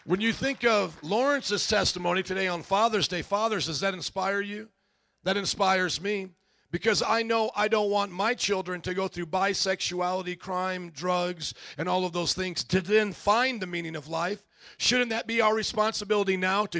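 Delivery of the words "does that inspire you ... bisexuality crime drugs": normal talking voice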